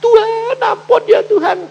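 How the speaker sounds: high, loud mock wail for mercy; imitated desperate plea, high and loud; crying out to God. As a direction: cry out pleading to God. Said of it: "baru teriak-teriak".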